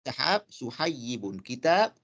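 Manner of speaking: سحاب then سحيب said slowly, set side by side; slow contrast of سحاب and سحيب; slow example pair. Shown as a demonstration. Teacher contrasting noun and diminutive, slowly. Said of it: "قلب هذه الألف ياء".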